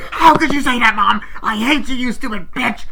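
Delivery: high, loud hysterical screaming; hammed-up shrieking tantrum; imitated freak-out. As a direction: act out a little girl screaming at mom. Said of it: "just freaks the fuck out".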